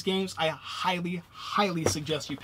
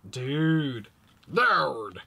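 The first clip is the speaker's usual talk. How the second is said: character voice. Shown as a demonstration. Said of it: "the way you think these characters would say it".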